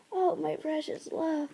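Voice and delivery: dying queen's soft endearment; faint tender whisper. Acted. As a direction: caress him with the words. Dying mate tender words.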